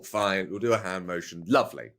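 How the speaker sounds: ordinary talk, nothing marked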